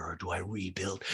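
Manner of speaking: whispered question; mock Erica, whispered. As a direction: breathe it dramatically. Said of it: "And Erica's like".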